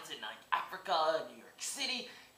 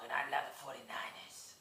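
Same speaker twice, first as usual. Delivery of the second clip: whispered, softer; whispered gangster aside; 5 dB quieter than usual. Acted. Gangster Red Dog confiding his team to viewers, whispered. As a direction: whisper it like a secret boast. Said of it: "gangster named Big Red Dog".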